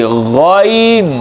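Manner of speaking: गॉइन drawn out slowly with rounded lips; Arabic ghain demo. Shown as a demonstration. Teacher demonstrates the letter for students, slow. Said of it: "पढ़ते वक्त पहले आपने होंठ अपने गोल कर लेने हैं".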